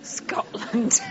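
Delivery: ordinary delivery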